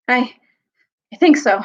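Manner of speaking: mildly winded, halting; panting. A narrator's reading. Boy answers his brother after the fight, panting. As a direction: restart after a short breath. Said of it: "panted Edmund".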